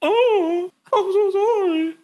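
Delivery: very high, squeaky mock apology; hammed-up high-pitched girl imitation; weird embarrassed voice. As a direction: put on a ridiculously high, embarrassed voice. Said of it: "had the weirdest voice".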